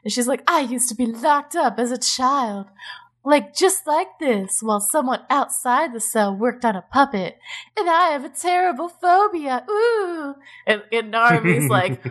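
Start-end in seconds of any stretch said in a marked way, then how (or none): 0.5-10.4 higher, hammed-up trembling damsel; mocking the heroine's dramatic line. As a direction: overplay the tragic heroine.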